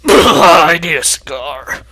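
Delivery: slow loud weary groan; exasperated. Acted. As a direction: sigh it out, fed up. Mech exasperated.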